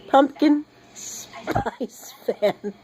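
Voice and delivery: very slow, stumbling over pumpkin spice fan with a laugh; slow tongue twister, laughing. Shown as a demonstration. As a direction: drag out Pumpkin spice fan slowly, chuckling. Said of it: "Say that ten times fast".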